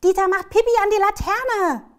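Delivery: high, very loud excited outcry. A narrator's reading. Child reports the dog's mischief to grandma, excited shout. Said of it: "sagt Lotte aufgeregt".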